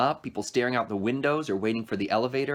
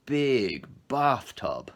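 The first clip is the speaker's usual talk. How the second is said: very slow, deadpan; dry deadpan; 3 semitones higher, much slower than usual. A narrator's reading. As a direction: let it drop dry and slow. Charon's dry reply.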